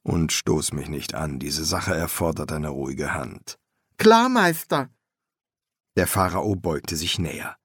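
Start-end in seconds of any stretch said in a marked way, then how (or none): 0.0-3.6 low, soft master's voice; steady low master; master embalmer, cautioning. A narrator's reading. 4.0-4.9 high, eager apprentice voice; high-pitched apprentice calling his master; young apprentice. A narrator's reading.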